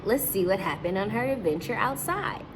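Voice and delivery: usual speaking voice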